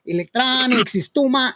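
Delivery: exaggerated Spanish accent. Host mimicking why Marco avoids the show, exaggerated Spanish accent. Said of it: "He doesn't speak that way".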